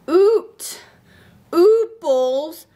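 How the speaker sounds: Oot, ooples with oo sounds; vowel swap demo. Shown as a demonstration. Teacher showing kids the U swap. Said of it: "And then you".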